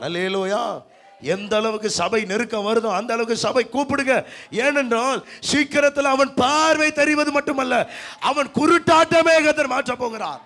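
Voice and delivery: loud, high, climbing to a shouted promise; full-force preaching. Acted. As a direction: rouse the congregation with a forceful proclamation. (உரத்த எழுச்சிப் பிரசங்கம்)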